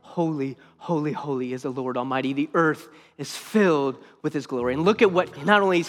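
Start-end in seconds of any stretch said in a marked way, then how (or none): 0.0-4.7 low, soft and hushed, almost to himself; hushed reverent reading. Acted. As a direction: breathe the words out in awe, very softly.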